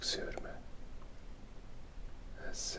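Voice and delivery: usual speaking voice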